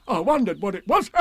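high tiger voice with chuckles; cheery storybook tiger; Tigger. A narrator's reading. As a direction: muse high and bouncy, chuckling. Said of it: "said Tigger".

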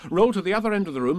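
plain reading voice

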